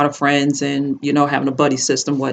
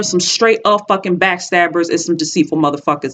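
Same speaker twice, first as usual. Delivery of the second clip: ordinary talk, nothing marked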